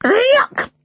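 high squawked cry of disgust; loud parrot. Acted. Parrot interrupting the family at breakfast, loud and high. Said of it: "said Madison loudly".

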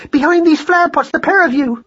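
very high frantic command; panicked mother's orders; urgent order. A narrator's reading. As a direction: shoo them into hiding, high and urgent. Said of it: "hissed Madeline".

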